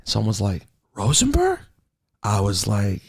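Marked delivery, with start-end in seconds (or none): 0.9-1.6 high-pitched questioning call; surprised recognition. Retold or imitated. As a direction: imitate a stranger spotting someone, voice shooting up. Imitates someone recognizing him in elevator.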